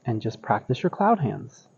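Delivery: soft, soothing guided relaxation; serene tai chi cues. Acted. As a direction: ease them into calm breathing. Guides relaxed breathing practice.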